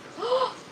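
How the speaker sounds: sharp high gasp of shock; a sudden gasp as the stem snaps. Real, not acted. Gardener on live stream sees plant break, sharp gasp.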